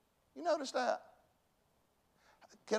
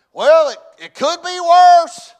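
high, slow, full-out shout; shouted self-encouragement; 12 semitones higher, 19 dB louder, slower than usual. Acted. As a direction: holler it out to buck yourself up. Shouts his motto to encourage himself.

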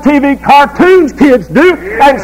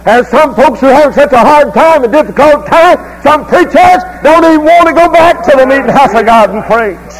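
fiery preaching; 4 semitones higher than usual. Acted. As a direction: preach it hard to the congregation. Preacher building to full force.

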